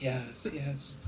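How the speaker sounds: soft, slow, worried creature voice; fretful kobold murmur. Acted. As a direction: coo over the hurt master. Kobold massaging hands.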